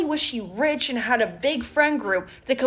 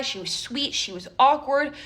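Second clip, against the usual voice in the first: usual speaking voice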